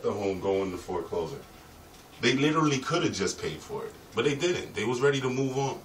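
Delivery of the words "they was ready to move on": normal talking voice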